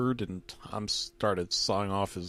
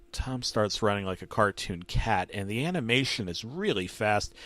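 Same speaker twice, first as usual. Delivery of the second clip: ordinary delivery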